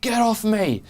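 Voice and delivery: sharp high shout. Acted. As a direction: yell it, shoving someone off. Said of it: "and quickly say".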